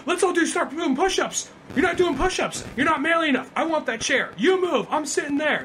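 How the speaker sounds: high, pushy mock tough guy; macho boss voice. Jesse Eisenberg bossing coworkers in a high macho voice. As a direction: bark orders like a fake alpha. Retold macho takeover of office.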